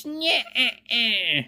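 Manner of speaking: high nasal-free cackling "nyeh eh eh"; witch laugh. Acted. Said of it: "That sounds like a witch laugh when I do that".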